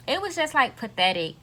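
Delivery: ordinary talk, nothing marked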